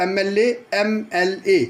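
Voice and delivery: एम एल ए said slowly, each letter clear, starting on an eh vowel; teacher sounding out एम एल ए; slow demo pronunciation. Shown as a demonstration. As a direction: spell out एम एल ए slowly so the opening vowel sound is heard. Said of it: "सुन लो".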